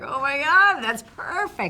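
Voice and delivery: loud, high excited exclamation. Retold or imitated. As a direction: burst out with delight. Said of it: "I was like".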